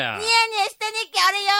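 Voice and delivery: high-pitched retort in dialect; nagging wife in a skit. Acted. Wife mocks husband's denial, needling. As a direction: throw his denial straight back at him. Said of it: "でけえ声出して".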